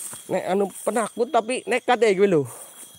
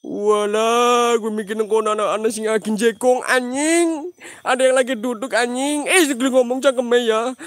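startled yelling; 5 semitones higher, 5 dB louder than usual. Real, not acted. Startled by something sitting there.

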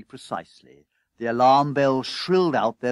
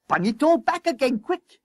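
high, urgent yell; frantic boss at a high pitch; 13 semitones higher, 4 dB louder than usual. A narrator's reading. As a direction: bark a panicked order. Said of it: "yelled his leading fireman".

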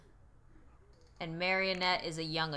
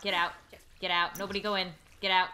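ordinary talk, nothing marked

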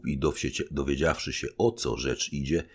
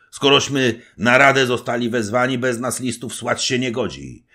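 higher, louder, threatening resolve; stern threat; 9 semitones higher, 9 dB louder than usual. A narrator's reading. Little knight warns the general, stern threatening tone. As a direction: warn them off, unbending. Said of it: "groźnie wąsikami ruszał".

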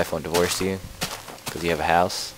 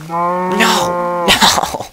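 high, loud cry of no; loud protest; 12 semitones higher, 9 dB louder than usual. Pretend. Player losing the game cat, loud high protest. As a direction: wail it out at full volume.

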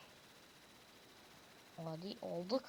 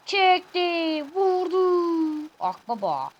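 screaming football commentator; 6 semitones higher, 7 dB louder, much slower than usual. Acted. Calling the shot like commentator.

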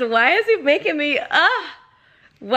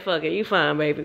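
giving up; 8 semitones lower, 5 dB quieter than usual. Retold or imitated. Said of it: "She said".

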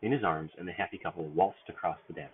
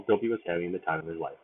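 usual speaking voice

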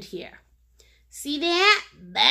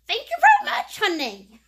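gushing thanks; 7 semitones higher, 5 dB louder than usual. Real, not acted. Thanking a viewer by name.

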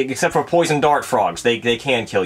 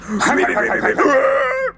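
evil laugh; 10 semitones higher than usual. Acted.

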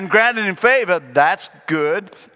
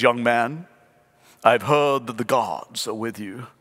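put-on a lower, regal Pharaoh in a British accent; lightly put-on British accent, lower and slower; 8 semitones lower, 4 dB quieter than usual. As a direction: speak as a grand British Pharaoh from his throne. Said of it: "I'm sure he says it with a British accent".

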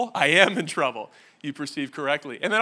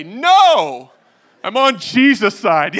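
much louder and higher, slow proclamation; mock defiant; 5 semitones higher, 13 dB louder, much slower than usual. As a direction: declare it grandly. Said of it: "I wanna say".

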